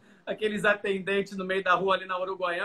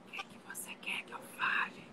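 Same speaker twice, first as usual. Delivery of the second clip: barely audible whispered question; shy student whispering; 12 dB quieter than usual. Retold or imitated. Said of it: "ele tem uma voz tão baixinha".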